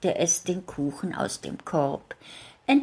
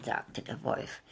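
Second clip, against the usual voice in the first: ordinary delivery, nothing marked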